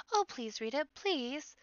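desperate pleading. Acted. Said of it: "The little rabbits are desperate".

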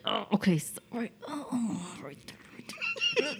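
faded, breathy mock moan; a frustrated plea trailing to a breath; softly imitating herself straining. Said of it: "frustrated 'cause I can't come".

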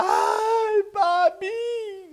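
high, loud wail for daddy; piercing sustained cry of a hurt child; imitated crying scream.

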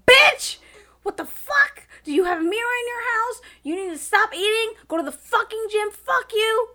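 high, loud mock fury; inner rant. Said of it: "inside I was just like".

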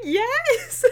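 high, laughing little yes; teary sister admitting. Retold or imitated. Sister admitting she cried, high and laughing. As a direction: squeak it out through tears and giggles. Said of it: "And she's like".

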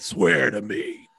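slow gravelly growl; gravelly Batman voice. Retold or imitated. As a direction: rasp it like a caped hero. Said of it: "talk in a gravelly voice at random moments".

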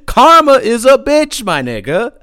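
loud laughing through the words. Real, not acted. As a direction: cackle it out, delighted. Laughing at cousin's lost hat.

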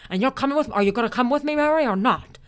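loud, fervent old-movie hero impression; George Bailey. Shown as a demonstration. Urging sweetheart to come along, old-movie hero impression. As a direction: build to an impassioned film-hero outburst. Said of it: "I can do George Bailey great".

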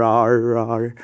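imitando um rosnado de [name], mais alto; rugido da [name]. Retold or imitated. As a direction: rar felino de diva pop. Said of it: "chega o comunicado da [name] e faz". (imitação leve do rar da [name])

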